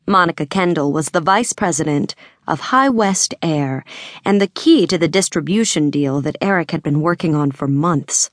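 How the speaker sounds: ordinary delivery, nothing marked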